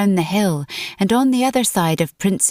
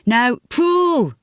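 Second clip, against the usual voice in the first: high, urging call; urgent order to heave; 7 semitones higher than usual. A narrator's reading. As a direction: call out the command, pitched up high. Munro calling an order from grave.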